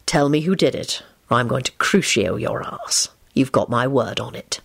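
low, controlled menace; stern threat. A narrator's reading. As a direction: threaten firmly without raising the voice.

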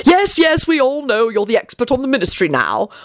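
high-starting irritable dismissal; mildly snappish sarcasm; growled. A narrator's reading. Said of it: "Arthur growled".

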